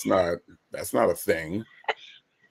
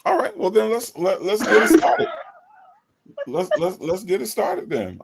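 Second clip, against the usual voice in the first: excited; 10 semitones higher than usual. Real, not acted. Hyped kickoff of the show.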